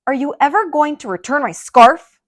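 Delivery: loud, high irritated shout; grumpy yell. A narrator's reading. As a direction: snap at a passerby. Grumpy grape yelling.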